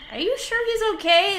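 worried, high-pitched check; concerned question. Acted. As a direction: ask it in alarm, pitched well up. Doubting the gator keeper's claim.

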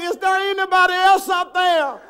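high, loud hollered punchline; comic acted yell for someone else; calling out. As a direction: shout past the voice for another rescuer. Man calls out, punchline.

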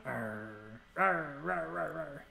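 low, soft zombie growl; playful zombie groan at the dog. Pretend. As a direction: growl softly like a lazy zombie. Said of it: "You wanna be a zombie?".

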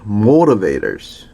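"Motivators" with both t's flapped to a quick d-like tap, "mo-ruh-vay-ders"; American flapped t in "Motivators". Shown as a demonstration. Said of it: "플랩이 됩니다".

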